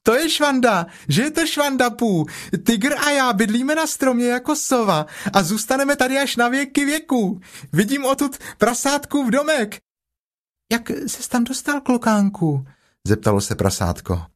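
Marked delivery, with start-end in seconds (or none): 0.0-9.8 put-on very high, childlike chatter; high-pitched young kangaroo; excited little Roo. 10.7-12.7 very high, small piglet voice; high-pitched little animal. Acted. Piglet asks Roo up there.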